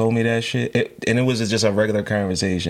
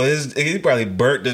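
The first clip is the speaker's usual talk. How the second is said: usual speaking voice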